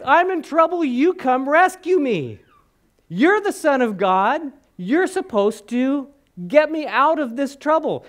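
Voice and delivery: begging to be rescued; John pleading from the dungeon; desperate prisoner. Retold or imitated.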